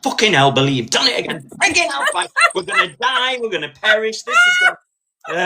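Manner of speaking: imitating panicked husband, high and squealing; Lee panicking. Husband panics at the empty petrol dial, mock squeal. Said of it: "went into Lee mode of like".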